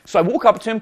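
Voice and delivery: usual speaking voice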